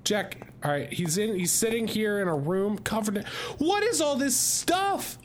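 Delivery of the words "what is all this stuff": surprised. Real, not acted. Finds the snack-filled room.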